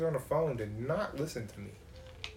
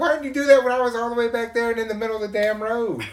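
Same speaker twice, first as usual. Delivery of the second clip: louder, exasperated, laughing; mock complaint to car; 8 semitones higher, 5 dB louder, faster than usual. Driver scolding his car for beeping too late, exasperated. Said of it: "I'm like".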